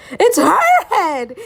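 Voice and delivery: high, loud horrified cry; terrified cry. A narrator's reading. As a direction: shout it in horror, loud and high. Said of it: "he cried".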